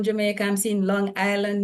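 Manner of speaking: usual speaking voice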